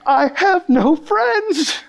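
high, slow mock-crying squeak; squeaked-out sob. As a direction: squeak it out like a bawling little girl. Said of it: "something just squeaks out".

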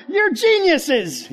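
drawn out, high and loud mock awe; teasing the students with exaggerated praise; mock amazement. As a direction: gush over them as if stunned.

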